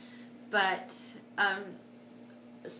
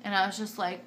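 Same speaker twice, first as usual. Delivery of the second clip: normal talking voice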